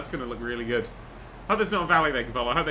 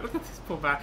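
usual speaking voice

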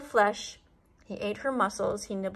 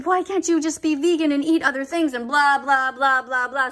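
mock crying plea; 4 semitones higher, 7 dB louder than usual. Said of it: "she's gonna be crying".

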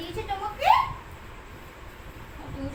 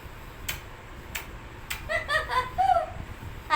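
airy unvoiced burst of laughter; breathy laugh; 11 semitones higher than usual. Real, not acted. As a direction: laugh out while cuddling. Laughing again at pet. (হাসিতে ফেটে পড়া)

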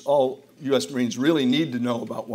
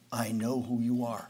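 slow, softer murmur of a recognizing stranger; quietly, retold; 3 semitones lower, 7 dB quieter, slower than usual. Older man at airport quietly tells him, slow and soft. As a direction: lean in and say it low and slow. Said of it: "he says very quietly".

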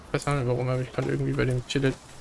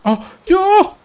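excited shout; 10 dB louder than usual. Real, not acted. Excited cry at game find.

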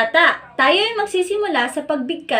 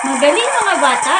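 loud cheering praise; excited praise; 3 semitones higher, 6 dB louder than usual. Real, not acted. Teacher congratulating the children, loud and cheerful. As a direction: call out your pride in the class.

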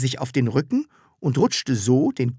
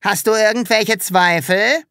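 higher, louder menacing question; bully Hubert threatening Sams; 8 semitones higher, 5 dB louder than usual. A narrator's reading. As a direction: loom over him and threaten. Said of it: "fragte drohend".

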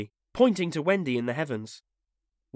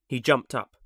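plain reading voice

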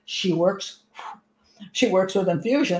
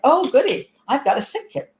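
gleeful, higher and louder on goody; mock glee; 6 semitones higher, 4 dB louder than usual. Mother in a mock voice, gleeful about her sick kid. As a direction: play the mother delighted by illness. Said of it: "It's like".

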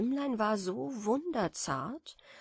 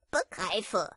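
giggling. A narrator's reading. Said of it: "kicherte der Klopfkäfer".

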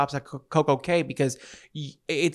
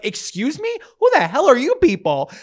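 high-pitched affronted comeback; indignant retort; 9 semitones higher, 5 dB louder than usual. Retold or imitated. Drunk latecomer answering passengers, indignant. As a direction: fire back in mock outrage, pitch way up.

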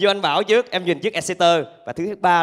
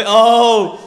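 loud high gasp of surprise; surprised oh; 5 semitones higher, 11 dB louder than usual. Real, not acted. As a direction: react in sudden shock. Sudden realization. (ồ ngạc nhiên)

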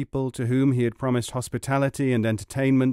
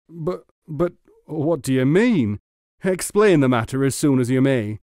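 stammering, louder puzzlement; puzzled; 2 semitones higher, 6 dB louder than usual. A narrator's reading. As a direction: demand an explanation, baffled. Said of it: "said the puzzled muleteer".

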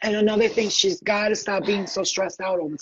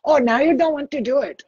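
put-on teasing spirit in an Indian accent; higher-pitched mock Indian accent, needling; 5 semitones higher, 4 dB louder than usual. Laughing spirit teases the reluctant psychic, Indian accent. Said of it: "I do the Indian accent. She's like".

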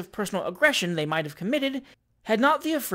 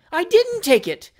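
high, loud, slow indignation; indignant; 11 semitones higher, 6 dB louder than usual. A narrator's reading. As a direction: deny it in outraged high pitch. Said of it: "said Mister Pickwick indignantly".

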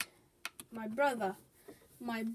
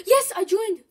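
much higher and louder, a gasp then a burst of joy; excited gasp; 8 semitones higher, 11 dB louder than usual. Real, not acted. Gamer finally joining the tournament, gasping with joy. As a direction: celebrate like you just made it in.

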